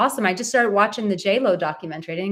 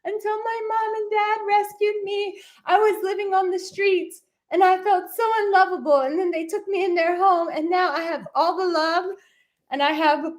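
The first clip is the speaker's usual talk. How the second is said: much higher, cutesy, sing-song voice for the dog telling its sad story; speaking as the dog; 12 semitones higher than usual. Pretend. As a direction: play the rescue dog, high and sweet, with mock tears halfway. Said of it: "Abby's like".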